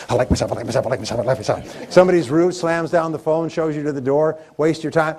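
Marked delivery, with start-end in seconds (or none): 0.1-1.9 rapid, rhythmic repetition; quick pumping mantra. Shown as a demonstration. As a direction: pump yourself up with each line. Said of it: "keep pumping it up".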